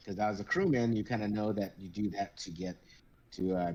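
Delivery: ordinary talk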